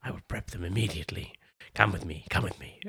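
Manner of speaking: quiet, fast servile assistant voice; soft, fast and eager to serve; hunchback assistant. Acted. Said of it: "And he's like".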